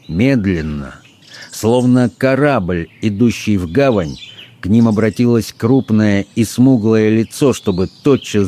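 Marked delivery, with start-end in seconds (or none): none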